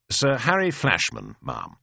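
crisp military self-introduction, name pitched high; soldier at attention. Acted. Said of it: "says I, shoulders back, chin up".

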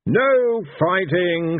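high, drawn-out shouted order; shouting. A narrator's reading. Blind Io stops the Horde drawing weapons, shouting. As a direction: shout it slowly as a command. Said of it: "shouted Blind Io".

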